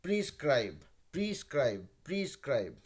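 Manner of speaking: प्रिस्क्राइब said clearly as pris-kraib, stress on kraib; pronunciation demo. Shown as a demonstration. Teacher models the word for the class, crisp and clear. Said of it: "वैसे ही pronounce भी कर रहा है".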